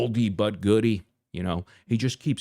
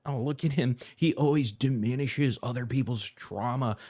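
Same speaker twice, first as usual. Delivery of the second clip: normal talking voice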